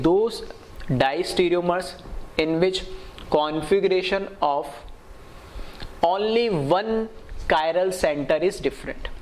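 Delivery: slow, spaced-out repeat for note-takers; deliberate teacher read-out with pauses; slow dictation. Real, not acted. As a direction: dictate the definition phrase by phrase.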